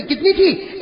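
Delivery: ordinary talk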